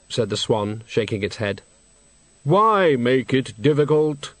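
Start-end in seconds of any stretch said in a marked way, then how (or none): 2.4-4.3 slow, hollow, solemn deathly voice; Death's voice. Acted. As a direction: speak as grave, patient Death. Death speaks.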